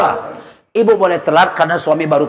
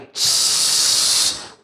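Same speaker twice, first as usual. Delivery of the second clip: long unvoiced shush; hushing shh; 5 dB quieter than usual. Wife hushing the household mid-prayer, long shush. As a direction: hush the noise mid-prayer.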